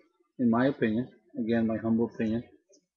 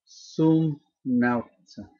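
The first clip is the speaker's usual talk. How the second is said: slow demo; much slower than usual. Shown as a demonstration. Teacher modelling the Latin slowly for students. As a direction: sum nauta said slowly, word by word. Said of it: "So if I want to say I am a sailor".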